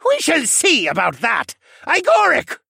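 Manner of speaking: high, loud villain summoning his creature; defiant villain. Acted. Villain sets his creature on the hero, shouting defiantly. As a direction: call the monster out at full volume.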